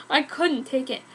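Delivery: usual speaking voice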